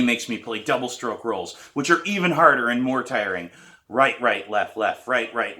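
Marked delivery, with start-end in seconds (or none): none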